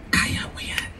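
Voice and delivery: soft unvoiced whisper; hushed "you can do it"; whispered encouragement. Shown as a demonstration. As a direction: breathe it like a secret nudge.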